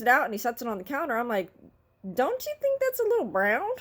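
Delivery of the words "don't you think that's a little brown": squeaky high, sweetly innocent ask; high fake-innocent complaint; high mock-polite question. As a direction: put on a high sugary voice to question it. Asking worker about burnt sub.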